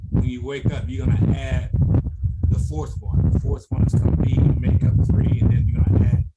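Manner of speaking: loud, building energy; excited coach. Real, not acted. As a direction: fire up the team.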